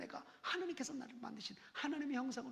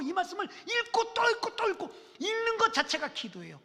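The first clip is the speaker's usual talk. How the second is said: loud, high urging; preaching exhortation; 6 semitones higher, 12 dB louder than usual. Real, not acted. Pastor urging the church to pray, loud. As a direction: drive the call to pray home. Closing exhortation at full force.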